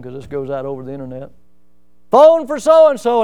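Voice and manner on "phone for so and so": loud, slow call down the hallway; drawn-out hallway call. Retold or imitated. Calling a roommate down the hallway.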